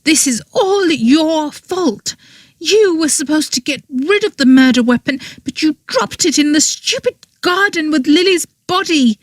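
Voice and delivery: put-on girl voice berating her accomplice; angry blame. A narrator's reading. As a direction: blame him, hard and high. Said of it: "she snarled".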